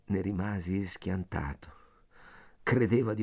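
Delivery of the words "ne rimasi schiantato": ordinary delivery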